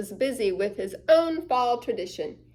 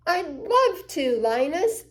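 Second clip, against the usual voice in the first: delighted; 5 semitones higher, 3 dB louder than usual. Acted. Sally's delighted reply.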